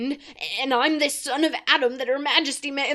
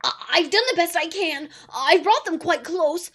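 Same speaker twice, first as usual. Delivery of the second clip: frightened pleading; 8 semitones higher, 7 dB louder than usual. A narrator's reading.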